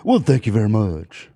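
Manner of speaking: [name] thank you very much. Pretend. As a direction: do the [name] catchphrase. Classic [name] catchphrase.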